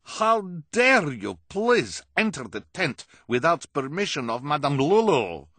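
high, loud, slow fake foreign accent crying out; outraged fortune teller in a put-on accent; Madame Lulu's accent. Angry carnival boss scolding the children in a put-on accent. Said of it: "Madame Lulu cried".

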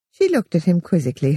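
ordinary delivery, nothing marked

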